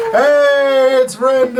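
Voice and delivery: high, loud drawn-out cheer; cheering crowd. Acted. Tavern crowd greeting Rendell, loud high cheer. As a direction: holler it like a whole tavern. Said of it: "the crowd inside goes".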